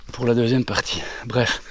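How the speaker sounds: usual speaking voice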